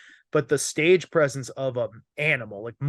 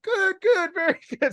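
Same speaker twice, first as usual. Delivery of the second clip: much higher, sing-song mock teacher; mock teacher praise; 4 dB louder than usual. Teacher praising his Spanish accent.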